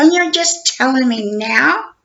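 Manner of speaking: slow, disbelieving indignation; indignant. Retold or imitated. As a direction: drag out the disbelief, rising. Retold angry call with detective.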